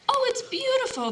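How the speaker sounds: high delighted gasp of praise; exclaimed. A narrator's reading. Woman admiring a teacup in a shop, high delighted exclaim. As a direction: gush over the teacup. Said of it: "the woman exclaimed".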